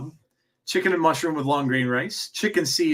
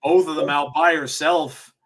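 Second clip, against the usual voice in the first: wrestling commentator. Real, not acted. Calls the wrestling match live.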